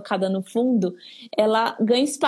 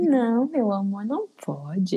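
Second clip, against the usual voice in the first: sweet-talking no; slower than usual. Shown as a demonstration. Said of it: "Mas você fala assim".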